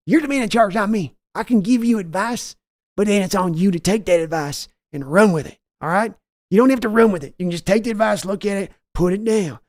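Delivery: high, loud bellow of an angry team owner; Jerry Jones yelling. Retold or imitated. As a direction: yell for your son like a furious boss. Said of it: "your Jerry Jones voice yelling at Steven".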